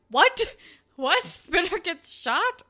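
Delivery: high, loud repeated what, laughing; shocked disbelief. Real, not acted. Viewer reacts to Spinner getting shot, loud shocked disbelief. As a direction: shriek it in disbelief. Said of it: "I was like".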